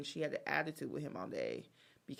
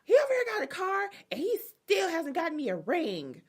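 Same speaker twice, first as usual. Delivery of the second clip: high, fast, louder complaining; mock indignant girlfriend; 11 semitones higher, 6 dB louder than usual. Girlfriend griping in her interview, high mock outrage. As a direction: rattle it off outraged and shrill-high. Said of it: "she's like, in her interview".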